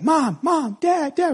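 put-on high-pitched small child pestering parents; little boy calling. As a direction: call out like a little kid in the back seat. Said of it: "when he was, like, three or four".

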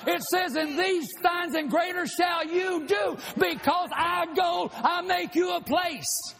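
rising into a shouted sermon; preaching at full force. Real, not acted. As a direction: preach it up to the rafters.

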